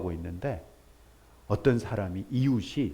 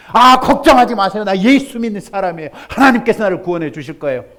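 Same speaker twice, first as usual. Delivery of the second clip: loud, fast, self-assured brush-off; confident refusal; 8 semitones higher, 13 dB louder, faster than usual. Acted. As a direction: wave off the rescue smugly. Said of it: "그래".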